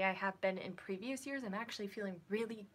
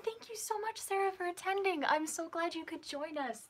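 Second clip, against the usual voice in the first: high-pitched, gushing thanks; excited gratitude; 8 semitones higher than usual. Real, not acted. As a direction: thank a fan with delighted, rising energy. Thanking an excited viewer warmly.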